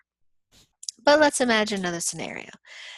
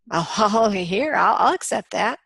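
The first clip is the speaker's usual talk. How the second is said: laughing acceptance; 7 dB louder than usual. Retold or imitated. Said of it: "Somebody there says".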